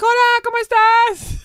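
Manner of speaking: self-mocking, very high-pitched greeting; overeager greeting. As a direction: sing out a mock-cheery Spanish hello. Retelling own overeager Spanish greeting.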